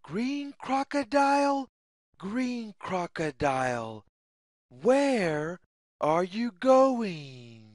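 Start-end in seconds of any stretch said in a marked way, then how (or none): none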